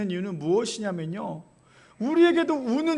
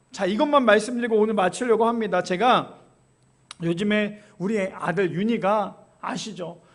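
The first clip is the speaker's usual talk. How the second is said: normal talking voice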